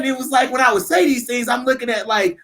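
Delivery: ordinary talk, nothing marked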